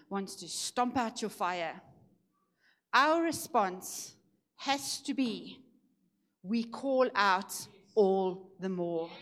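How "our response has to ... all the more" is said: slow emphatic preaching. Acted. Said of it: "preaching climax slow".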